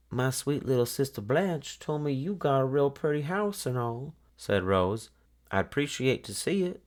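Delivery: put-on high, wheedling little-girl voice; a young girl buttering up an old woman; sugary girl voice. A narrator's reading. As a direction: sweet-talk her, pitched well up. Rose sweet-talks the old woman.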